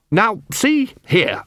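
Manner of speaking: slow, drawn-out indignant clown; indignant protest. A narrator's reading. Clown objecting to the corporal, slow and indignant. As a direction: stretch it out, bristling.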